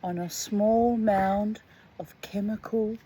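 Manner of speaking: slow, deliberate reading aloud; story narration. Acted. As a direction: read the story slowly and evenly. Reads the short story aloud.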